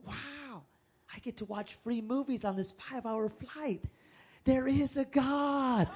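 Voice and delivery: a slowed, amazed whisper of joy; delighted wonder. Retold or imitated. To the church, marveling at free in-flight movies. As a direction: savor the discovery like a gift.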